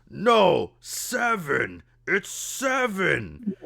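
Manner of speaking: high, loud, drawn-out ogre protest; insistent ogre. Acted. Ogre arguing with the other head, insistent bellow. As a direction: bellow stubbornly, stretching the final word. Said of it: "he goes, he goes".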